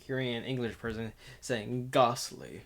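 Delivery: usual speaking voice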